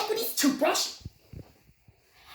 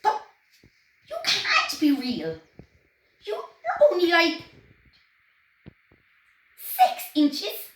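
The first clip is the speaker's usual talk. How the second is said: higher, louder protest of disbelief; disbelieving exclaim; 6 semitones higher, 4 dB louder than usual. A narrator's reading. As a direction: protest he can't be real. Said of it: "exclaimed Henry".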